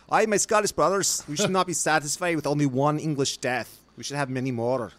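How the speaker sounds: hammed-up Scottish accent; Scottish rally cry. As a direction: play a fiery Scot stirring up his brothers. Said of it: "dwarvish accent from Lord of the Rings".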